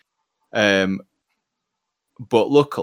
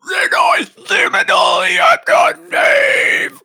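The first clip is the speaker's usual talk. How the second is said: death metal growl; 7 dB louder, slower than usual. Acted. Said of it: "In purely inwards".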